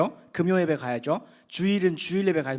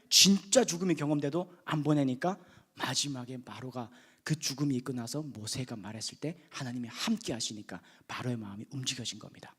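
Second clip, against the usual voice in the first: ordinary delivery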